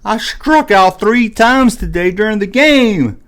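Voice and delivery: dejected little leaguer; tired friend. Retold or imitated. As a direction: mope it like a worn-out kid after a bad game. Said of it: "he's like".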